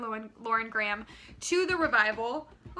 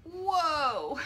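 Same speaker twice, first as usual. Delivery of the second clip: sudden startled exclamation; startled whoa; 4 semitones higher than usual. Real, not acted. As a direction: caught off guard, blurt it out. Something startles her mid-sentence.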